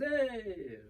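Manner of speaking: high, falling, half-hearted hurrah; anticlimactic cheer. Retold or imitated. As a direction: announce a winner as a letdown.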